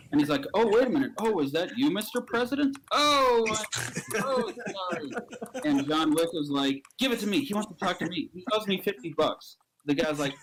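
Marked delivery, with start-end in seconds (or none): 0.5-5.1 high, startled, apologetic; flustered realisation. Acted. Man discovers the President is on the phone, flustered. 7.0-9.6 higher, pushy demand; insistent demand. Acted. Storyteller plays a man demanding the phone, insistent. Acting John Wick grabbing for phone.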